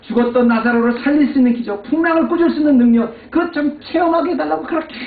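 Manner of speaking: high, loud sermon climax; full-force preaching. Acted. Pastor challenging the congregation, loud fervent preaching. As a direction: drive it home to the pews at full force.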